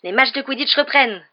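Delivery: high, loud thrilled shout; overexcited roar. A narrator's reading. Wood roaring about Quidditch, overexcited. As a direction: roar it with glee. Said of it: "rugit Dubois surexcité".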